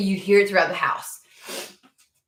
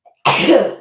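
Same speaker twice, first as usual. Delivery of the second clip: loud real sneeze, pitched up; 4 semitones higher, 12 dB louder than usual. Real, not acted. Sneezing yet again while filming her vlog, loud.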